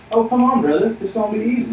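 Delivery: high, loud, breezy brush-off; mock breezy reassurance. Imagined Jesus waving a new follower in, mock breezy.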